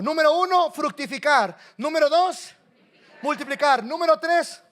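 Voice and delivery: rousing pulpit roll-call; calling out points. Real, not acted. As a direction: call out each point to the crowd. Calls out points to congregation.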